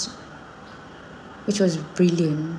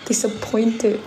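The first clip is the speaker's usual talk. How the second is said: mock proud mother; 4 semitones higher, slower than usual. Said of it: "I'm like".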